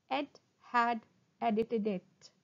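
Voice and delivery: slow, separated words; slow tongue twister. Shown as a demonstration. Teacher models a twister for students, slowly. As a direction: pause after each word. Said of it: "first try saying them slowly".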